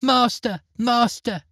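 high, sweet cry of alarm; a magic harp calling for its master. Acted. Harp calling her master as Jack steals her, high and sweet. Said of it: "the harp cried out".

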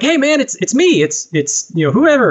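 bright imitation of a star-struck fan; mock excited fan. Fan greeting him at knife show.

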